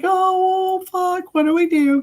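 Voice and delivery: high panicked retelling; half-hearted freak-out; mock panic. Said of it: "we were like".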